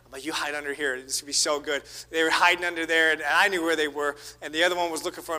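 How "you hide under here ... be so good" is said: conspiratorial hush. Retold or imitated. Dad whispering to hiding kid.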